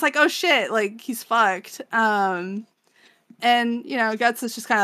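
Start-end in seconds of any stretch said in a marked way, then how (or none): 0.2-0.7 high, loud onlooker reaction; hammed-up spectator shock; mock shock. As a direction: exclaim like a stunned crowd. Acting out everyone's shocked reaction.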